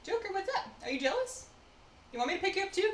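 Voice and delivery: high sing-song voice for the dog; pet talk. Real, not acted. Talking sweetly to her dog in a high voice.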